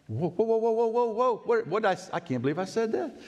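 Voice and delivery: high, rising panicked whoas and nos; mock panic, voice shooting up; retold panic. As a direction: replay the moment of panic, pitch climbing. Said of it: "I'm just panicking".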